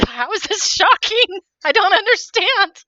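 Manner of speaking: high, loud, laughing disbelief; can't believe this is shocking anyone, say it loud through a laugh; exasperated outburst. Real, not acted. Host laughing loudly in disbelief at the offended guest. Exasperated reply during the argument.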